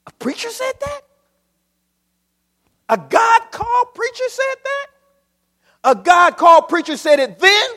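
high, incredulous squeal of disbelief; mock amazed preacher; astonished. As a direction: feign shock at the bold prophet. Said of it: "mock astonishment".

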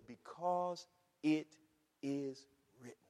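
BECAUSE... IT... IS... WRITTEN, slow and separated; deliberate citation. Acted. Preacher quoting God's reason, slow deliberate IT IS WRITTEN. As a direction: pause between words, letting WRITTEN drop soft and low. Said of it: "He said".

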